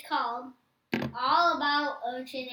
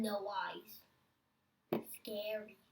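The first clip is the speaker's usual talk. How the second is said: spooky whisper; 6 semitones lower, 9 dB quieter, much slower than usual. Pretend. Whispering about creepy shark.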